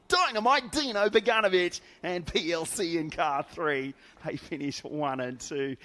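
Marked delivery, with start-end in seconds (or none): 0.1-3.9 higher, louder, punchy race call; hyped commentator call. Shown as a demonstration. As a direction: sell the name like a big race call. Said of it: "you have to say it like this".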